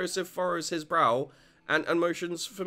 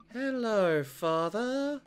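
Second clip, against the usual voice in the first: put-on much higher, eerie child greeting; high creepy twin voice; 6 semitones higher, much slower than usual. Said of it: "creepy twin shtick".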